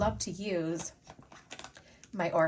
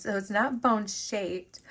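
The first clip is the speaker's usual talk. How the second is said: normal talking voice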